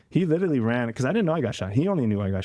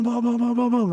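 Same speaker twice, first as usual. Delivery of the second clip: high, rapid-fire booms; imitated gunshots; 11 semitones higher than usual. Man retelling twelve shots fired at the car, imitated gunfire. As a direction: imitate a volley of shots in one high burst. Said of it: "I just hear just this boom".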